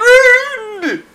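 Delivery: put-on shrieked ruined in Scottish accent. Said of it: "In Scottish accent".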